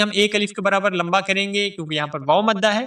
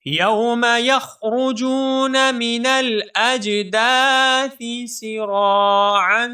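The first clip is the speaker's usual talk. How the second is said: slow, melodic tajweed chant; Quran recitation; 4 semitones higher, much slower than usual. Shown as a demonstration. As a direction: recite it drawn out like a qari. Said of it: "مکمل آیت مبارکہ کو revise کیجیے". (تجوید کے ساتھ تلاوت)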